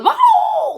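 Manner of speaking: high, loud mock dog yelp; over-the-top beagle cry, high and loud; imitating a beagle howl. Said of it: "he goes".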